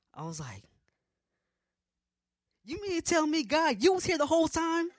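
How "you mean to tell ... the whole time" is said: loud, high-pitched incredulous outburst; indignant yelling. Retold or imitated. Marcher confronting God who was there, indignant yell. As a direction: yell it as an outraged question. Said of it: "I'm over here yelling at you".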